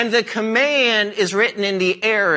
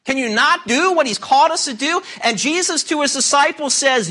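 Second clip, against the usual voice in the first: ordinary talk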